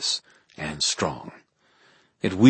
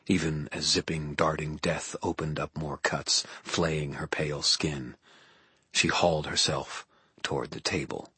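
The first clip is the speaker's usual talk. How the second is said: hushed grim narration; 3 semitones lower, 7 dB quieter than usual. A narrator's reading. Narrator drops to grim hush.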